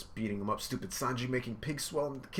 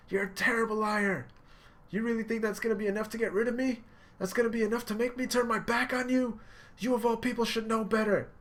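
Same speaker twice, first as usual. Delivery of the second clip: high, loud emotional yelling; impassioned shouting; 12 semitones higher, 4 dB louder than usual. Retold or imitated. Luffy yelling that Sanji is lying. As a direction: call him out, all heart. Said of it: "He's like".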